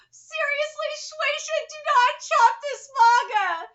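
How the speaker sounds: high, loud, begging cry; passionate plea. Real, not acted. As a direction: beg the publisher not to cancel. Pleading with the publisher.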